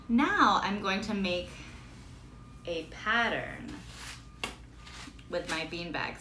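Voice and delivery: ordinary talk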